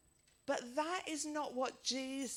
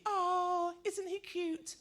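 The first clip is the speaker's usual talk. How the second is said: put-on high sweet old-lady coo; doting old lady cooing at a baby; 9 semitones higher, 4 dB louder, slower than usual. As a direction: coo over the pram like a doting granny.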